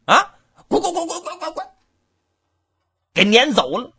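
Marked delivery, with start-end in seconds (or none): 0.1-1.7 high shouted 滚 with laughter, fading; shouting get out. Acted. Guard drives the farmer away, laughing shout. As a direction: shoo him off with repeated 滚.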